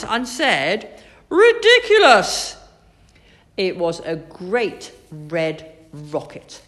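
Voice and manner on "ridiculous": high, loud indignant outburst; outraged exclamation. A narrator's reading. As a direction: explode in disbelief. Doctor outraged at rocket.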